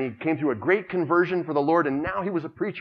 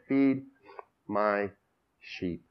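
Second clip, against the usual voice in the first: tender; 5 semitones lower, much slower than usual. Acted. Jesus' words read slowly.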